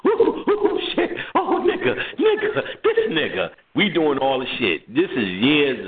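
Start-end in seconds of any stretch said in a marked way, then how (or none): 0.1-3.5 high whooping hype; woo-hoo laughing. Retold or imitated. Friends hooting at a pantsed guy, whooping high. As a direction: hoot and holler at your friend. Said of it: "We woo-hoo laughing".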